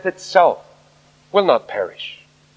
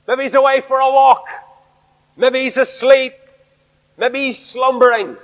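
raised, taunting, sarcastic jeers at false gods; mocking taunt; 7 semitones higher than usual. Elijah mocking the prophets of Baal, high sarcastic taunt. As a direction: sneer at the sleeping gods, pitched higher. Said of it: "You can hear him berating them".

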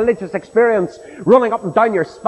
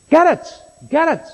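insistent; 7 semitones higher than usual. Real, not acted. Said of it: "preacher insisting".

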